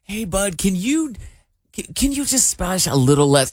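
put-on gentle ask to kid. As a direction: ease the kid into stopping, kindly. Host voicing himself to kid.

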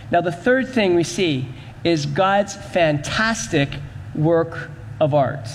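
ordinary delivery

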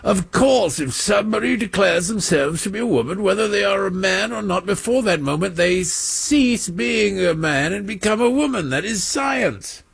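a teacup-and-pinky Englishman declaring science; snooty British accent. Retold or imitated. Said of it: "drank their tea with their pinkies out".

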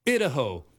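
"Idaho" said IDD-a-ho with short i; mock-serious botched "Idaho", opening like "it"; joking mispronunciation. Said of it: "Why can't we pronounce these states right?".

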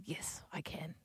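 whispered reply; soft whispered yes. Retold or imitated. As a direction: breathe it without voice. Mum replying in story.

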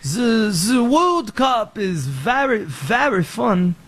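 slow, hammed-up French accent; badly faked French, drawn out word by word. Shown as a demonstration. Trying accents for World Cup talk, amateur French accent. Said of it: "Like France".

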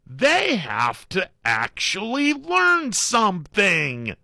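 put-on high, slow old man lecture; grandpa voice. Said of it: "Grandpa may have made some sense".

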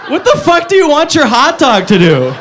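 ordinary talk

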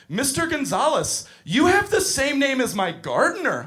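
put-on high, bright-eyed kid voice; little girl voice. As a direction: play a rich little girl innocently insulting. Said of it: "she goes".

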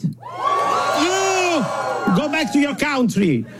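mock-outraged shouting; mock xenophobic shout. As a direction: yell it like a heckler, hammed-up. Mock heckle at fellow foreigners.

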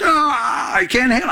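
high, hammed-up cry of torment; dramatic agony. Preacher playing Lucifer tormented by worship, dramatic. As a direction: overact someone who can't bear the noise. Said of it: "getting a little dramatic up here".

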